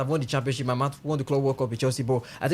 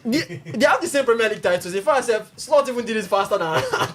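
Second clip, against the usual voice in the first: higher and louder, insisting over him; heated insistence; 8 semitones higher, 3 dB louder than usual. Real, not acted. Arguing back over a rival pundit, heated and loud. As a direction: push the point through the noise.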